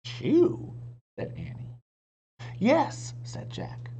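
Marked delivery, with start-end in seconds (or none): 0.1-0.7 puzzled. A narrator's reading. Annie puzzled. 2.6-3.1 firm yes. A narrator's reading. Jack emphatic.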